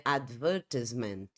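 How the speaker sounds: British English "advertisement", stressed on "ver"; British advertisement with short "tis"; British pronunciation. Shown as a demonstration. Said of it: "No inglês britânico, a pronúncia seria".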